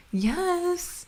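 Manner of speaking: put-on a high, soft kid's "Yes."; child's mock "Yes."; imitating Jackson. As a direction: mimic the little boy saying "Yes.". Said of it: "When he goes".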